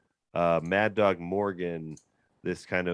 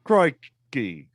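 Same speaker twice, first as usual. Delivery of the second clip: loud, high Australian exclamations; mock Aussie accent; 4 semitones higher, 6 dB louder, much slower than usual. Host taking up the invitation to offend Australians, in mock Aussie. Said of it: "alienate all the Australians you want".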